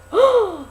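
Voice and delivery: sharp high gasp of shock; dramatic gasp. Acted. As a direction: gasp as if the curse just landed. Curse just spoken at feast.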